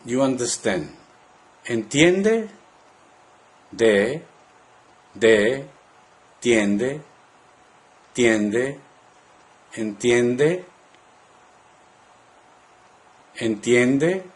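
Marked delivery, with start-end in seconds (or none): 1.7-2.5 "Entiende" ending on a high rise; careful model of "Entiende" as a question; rising question intonation. Shown as a demonstration. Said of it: "rising intonation at the end of the word". 3.7-14.2 "de", "tiende", "entiende", spaced out for repeating; part by part. Shown as a demonstration. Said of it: "Listen and repeat part by part".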